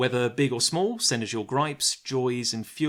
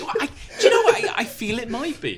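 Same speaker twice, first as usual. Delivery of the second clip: high, through a laugh; 12 semitones higher, 7 dB louder than usual. Real, not acted. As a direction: answer while giggling. Host answers still laughing.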